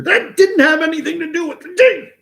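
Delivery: high, loud mock dismissive voice; mocking relative. Mocking family who got mad at him, high and loud. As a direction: parrot the relatives' brush-off mockingly.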